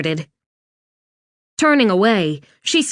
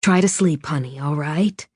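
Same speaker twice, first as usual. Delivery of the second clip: tender; 6 semitones lower, 4 dB quieter than usual. Acted. Mother tucks daughter in.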